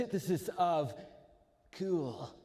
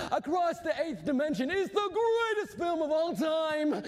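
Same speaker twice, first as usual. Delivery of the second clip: high, strained fanboy rant building to a wail; geek, outraged; 12 semitones higher, 3 dB louder than usual. Acted. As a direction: stretch it into an exasperated high-pitched tirade.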